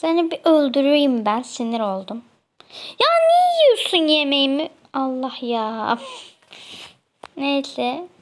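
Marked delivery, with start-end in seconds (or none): 3.0-4.7 high, loud complaining protest; indignant whine. Real, not acted. Gamer scolds another player for eating her food, shrill and loud. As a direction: whine at the thief, pitched way up.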